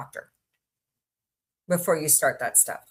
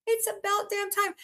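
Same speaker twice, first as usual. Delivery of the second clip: mock exasperated relief; 13 semitones higher, 4 dB quieter than usual. Real, not acted. Reacting to eco fireworks news.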